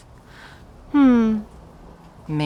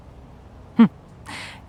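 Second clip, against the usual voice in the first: smug laugh. Acted. Taunting captive ex.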